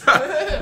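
quick burst of high laughter; a bright little laugh at his own joke; short high laugh. Real, not acted. Car wash worker laughing at his own trick.

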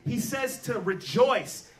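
normal talking voice

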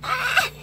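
short high yelp; real startled cry. Real, not acted. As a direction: flinch away. Pony startles her again.

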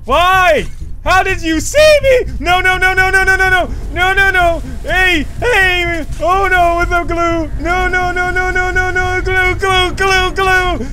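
high, loud cries of alarm; panicked yelling. Real, not acted. Player spotted by the enemy he is fleeing, panicked yelling. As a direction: yell out as if being chased down.

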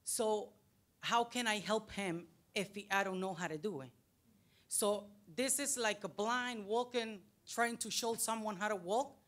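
normal talking voice

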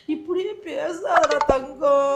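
soft, slow, drawn-out plea; tearful pleading. Acted. Mother begging her darling son not to talk so, tearful. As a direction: plead softly through acted tears.

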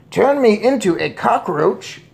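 high, loud question; outraged shout. A narrator's reading. Father scoffs at witch's powers, shouting. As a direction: throw it back in outrage. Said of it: "shouted Mister Jenkins".

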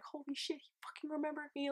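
high, hushed freak-out. Retold or imitated. Fan calling her friend about being remembered, freaking out. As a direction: gush it high and soft, in disbelief. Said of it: "freaking out".

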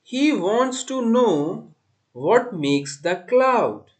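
measured verse, pausing between phrases; poem recitation. Shown as a demonstration. Teacher reciting for students to repeat, measured recitation.

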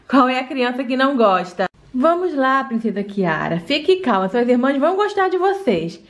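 low reassuring mom; mother voice. Acted. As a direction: soothe the little one as mom.

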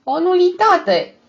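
high, loud, slow and gleeful; even thinner voice. A narrator's reading. Deaf neighbour delivering the joke's answer, high and thin. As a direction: crow the punchline in a thin high voice. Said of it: "rosti cu o voce și mai subțire".

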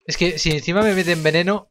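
ordinary talk, nothing marked

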